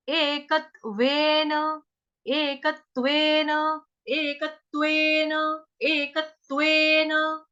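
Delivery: एकत्वेन with clear ए, slow; slow ekatvena. Shown as a demonstration. Teacher drills एकत्वेन slowly with ए sound. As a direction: stretch एकत्वेन syllable by syllable. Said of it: "ए वाला, A for apple".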